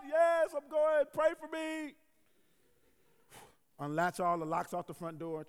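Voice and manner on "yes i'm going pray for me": drawn-out, high shouted answer; acted urgent shout. Husband to wife before stepping outside, acted urgent shout. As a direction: call back loudly, stretching the words.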